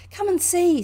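loud, high, delighted call; gleeful, high-pitched invitation. A narrator's reading. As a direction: call out, thrilled with the find. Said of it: "said the delighted Rat".

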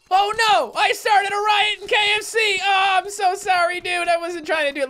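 high, loud mock-horrified wail; panicked apology. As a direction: cry out in hammed-up dismay, easing off slightly. Started a riot, apologizes loudly.

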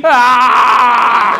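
high loud squawk, laughing through it; garbled phone shriek. Retold or imitated. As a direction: screech like a muffled phone voice. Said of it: "as close as I can get to what I heard".

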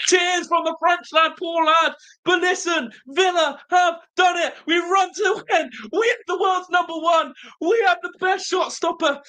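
high, breathless victory rant; real joy, voice cracking; overjoyed. Real, not acted. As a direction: gush to the chat, almost in tears. Euphoric rant after the win.

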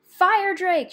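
high, loud urgent call; urgent high yell; shout. A narrator's reading. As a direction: shout the name to wake someone. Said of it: "she shouted".

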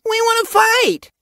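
very high, loud plea; dismayed protest. A narrator's reading. Apprentice barred from the battle, dismayed. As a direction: protest hotly in a high young voice.

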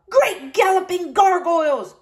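high loud bellow. A narrator's reading. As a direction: bellow in frustration. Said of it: "Horace bellowed".

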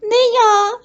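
very high mouse squeak. A narrator's reading. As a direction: squeak out a refusal. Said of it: "пишіть Круть".

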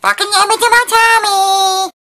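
high loud sing-song falsetto; shrill-free high mock squeal of delight; silly falsetto. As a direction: squeal it like a giddy cartoon. Sing-song falsetto about food.

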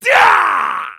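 a sharp, high shouted Ah; fierce attack cry; battle yell. Acted. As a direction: yell as you strike.

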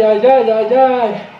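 ordinary talk

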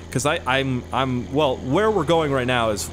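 ordinary talk, nothing marked

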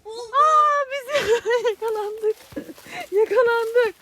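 high excited shout with laughter; yelp, then laugh being caught. Real, not acted. Caught in hide and seek.

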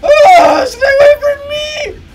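very high, loud screaming cry; panicked scream. Real, not acted. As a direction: shriek it out in panic. Gamer screams at wolf rider.

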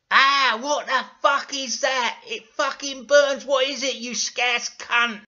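loud, high, drawn-out yelling; hammed-up burning panic; pained outburst. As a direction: howl in mock agony and outrage. Dougal burned by the potion.

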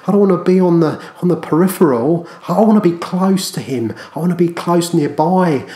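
high, loud fervent cry; full-force preaching. Real, not acted. Preacher crying out his longing to be near Christ, loud and high. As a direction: preach it out at the peak.

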